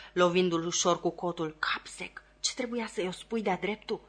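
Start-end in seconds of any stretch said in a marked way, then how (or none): 1.6-4.0 half-whispered reproach; hushed scolding. A narrator's reading. Secretary rebuking the helper out of the lawyer's hearing, hushed. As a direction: whisper the insult, then stay very soft. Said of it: "spuse încet secretarul".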